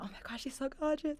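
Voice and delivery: high, soft gushing; hushed admiration. Retold or imitated. Retelling first look at a mentor, high hushed admiration.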